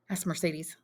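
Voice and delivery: ordinary delivery, nothing marked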